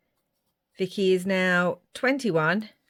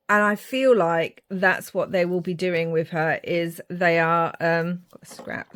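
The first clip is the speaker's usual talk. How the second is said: ordinary delivery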